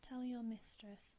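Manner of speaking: plain reading voice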